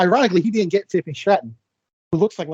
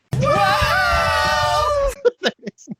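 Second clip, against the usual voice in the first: shrieking laugh. Real, not acted. Howling laugh at a joke.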